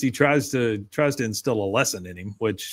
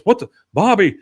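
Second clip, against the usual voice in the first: sudden higher, louder outburst; startled dad; 8 semitones higher, 6 dB louder than usual. Retold or imitated. Dad spots the unraked leaves and yells in surprise. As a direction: caught off guard, calling out at the boy.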